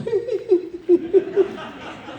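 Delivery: high, mimicked old-lady tittering; thin run of high put-on giggles; old ladies' titter. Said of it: "these two old ladies going".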